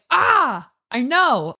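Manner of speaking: high bright eureka; as if an idea just hit; sudden realization. Retold or imitated. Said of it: "And I was like".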